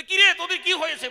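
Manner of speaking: high, very loud acted demand; alarmed shout. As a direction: burst out as the bewildered father. Returning father confronts his family. (হতভম্ব বাবার চড়া চিৎকার)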